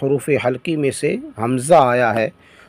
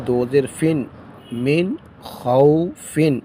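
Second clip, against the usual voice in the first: slow, measured letter-by-letter recitation; slow spelling drill; much slower than usual. Shown as a demonstration. Teacher drilling students in spelling, slow recitation. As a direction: chant each letter and vowel slowly.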